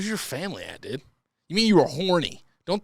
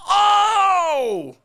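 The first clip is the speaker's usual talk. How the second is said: shocked shout; 8 dB louder than usual. Real, not acted. Reacting to shocking letter.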